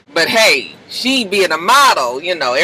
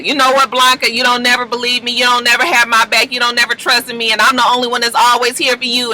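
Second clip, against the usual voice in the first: imitated rant, pitched up and relentless; retold tirade; 6 semitones higher than usual. Damon lays into Blanca for never believing him, imitated tirade. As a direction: go off on her in mock indignation. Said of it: "starts going off on Blanca".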